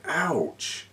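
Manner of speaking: ordinary delivery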